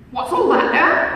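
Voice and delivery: loud, slow demanding bark; captain barking at the stranger, loud and slow; gruff cry. A narrator's reading. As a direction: call out to challenge the stranger. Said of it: "cried the captain".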